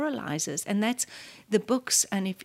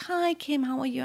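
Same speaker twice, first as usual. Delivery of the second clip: higher, light friendly greeting; a lightly voiced elderly statesman saying hello; Mandela greeting; 6 semitones higher than usual. Mandela welcoming Kim at his door, a light higher greeting. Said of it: "and he says".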